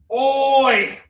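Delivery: loud Australian Oi call. Pretend. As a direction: shout it like an Aussie. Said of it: "pulling out my Australian accent".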